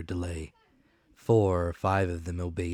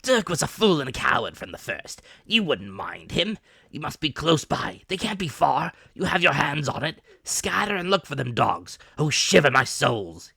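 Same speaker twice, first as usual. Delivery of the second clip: high, loud raging rant; furious cry; 8 semitones higher, 7 dB louder than usual. Acted. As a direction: lash the crew on. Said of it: "he cried".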